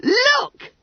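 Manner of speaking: very high, loud squeal; gleeful chirrup. A narrator's reading. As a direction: chirp it out like an excited schoolboy. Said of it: "chirruped Bunter".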